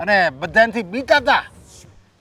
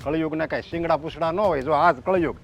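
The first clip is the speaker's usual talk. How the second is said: a low, much softer groan of despair to God; despairing lament; 7 semitones lower, 7 dB quieter than usual. Acted. Father bemoaning the dark age to God, hushed and despairing. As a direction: mutter it like a broken old man calling on God.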